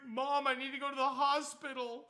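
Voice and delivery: panicked plea. Retold or imitated. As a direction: insist again to mom, scared. Panicked teen repeating to mom.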